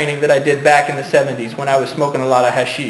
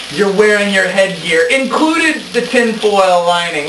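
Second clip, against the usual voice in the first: grand announcer; 4 semitones higher than usual. Pretend. Grand host introduction.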